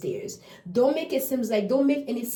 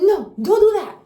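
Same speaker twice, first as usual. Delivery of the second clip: higher, louder parental no; firm no; 10 semitones higher, 9 dB louder than usual. Retold or imitated. Parent telling baby no, loud scolding. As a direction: scold the toddler, loud and high. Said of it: "So we are like".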